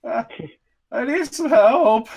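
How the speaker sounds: high-pitched, hesitant plea for help; pleading captive. Acted. Captive begs strangers in a cave for help, high pleading voice. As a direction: plead in a high voice, faltering between phrases. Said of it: "from the darkness, ... you hear".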